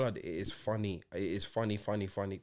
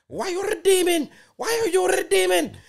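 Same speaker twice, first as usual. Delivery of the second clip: much higher, louder frantic complaint; mock angry scammer; 5 dB louder than usual. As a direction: squeal it like a furious scammer. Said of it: "So the scammers are getting mad".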